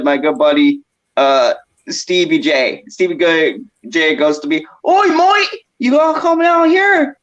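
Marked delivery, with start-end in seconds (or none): 4.9-7.2 mocking an Australian mate in a much higher, bad Australian accent; a clumsy Australian voice, pitched way up; bad Aussie accent. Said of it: "that is not an Aussie accent at all".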